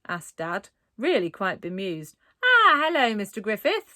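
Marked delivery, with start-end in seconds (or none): 2.4-3.9 higher, louder falsely cheerful greeting; brightly. A narrator's reading. Raj greeting Dad at night, brightly. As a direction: sound breezy as if nothing is odd. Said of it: "said Raj brightly".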